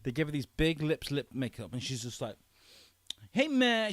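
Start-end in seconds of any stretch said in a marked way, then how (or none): 3.3-3.9 high, hammed-up sassy greeting; mock the sassy villain makeover; sassy girl.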